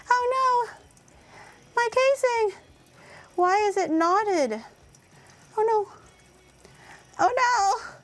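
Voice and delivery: rising distressed wail; high-pitched panic. Real, not acted. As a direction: squeal in dismay. Casing knotted, distressed.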